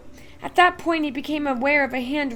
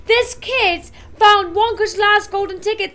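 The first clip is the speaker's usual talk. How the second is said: much higher and louder, yelling to everyone; excited shouting; 9 semitones higher, 10 dB louder than usual. A narrator's reading. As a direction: call the whole shop over.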